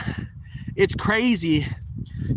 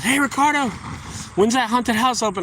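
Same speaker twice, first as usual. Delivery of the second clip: excited customer; 9 semitones higher than usual. Retold or imitated. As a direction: play the pumped customer calling out. Customer greeting him eagerly.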